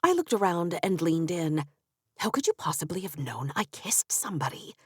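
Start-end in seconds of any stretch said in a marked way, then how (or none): 2.2-4.7 a soft secretive whisper. A narrator's reading. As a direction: lean in and whisper it. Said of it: "I whispered".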